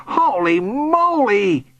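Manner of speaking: high, long-drawn amazement; amazed exclamation. A narrator's reading. Bully watches a man fly, amazed. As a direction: stretch it out in awe.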